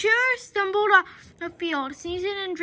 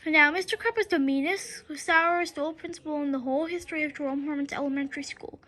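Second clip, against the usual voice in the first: plain reading voice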